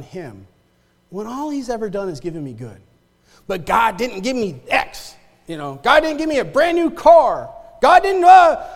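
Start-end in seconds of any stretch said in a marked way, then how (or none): 3.5-8.7 whiny complaint that climbs higher and louder; mock a spoiled child griping at God; childish whining. Preacher mocks churchgoers' entitled complaints, whining. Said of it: "Child foolishness".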